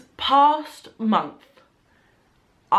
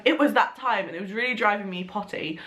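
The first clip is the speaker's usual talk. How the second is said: ordinary talk, nothing marked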